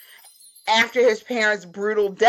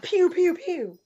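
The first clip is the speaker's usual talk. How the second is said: blaster sound effect; 8 semitones higher, slower than usual. Pretend. Mimicking blaster fire.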